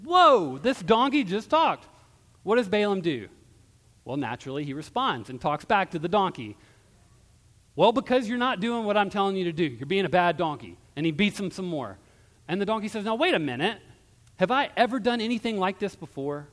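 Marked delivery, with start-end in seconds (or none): 0.0-1.9 high, loud acted amazement; astonished. Preacher acts out hearing a donkey talk, loudly astonished. 7.7-10.8 fast, raised-voice telling-off; scolding. Acted. Balaam talks back to his donkey, scolding. 13.0-16.5 raised, high-pitched protest; indignant objection. Acted. Donkey argues back after more beatings, indignant.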